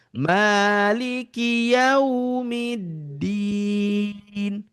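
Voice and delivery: slow Maliki yaumiddin, yau gentle; soft yaumiddin. Shown as a demonstration. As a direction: let yaumiddin flow softly. (yaumiddin lembut)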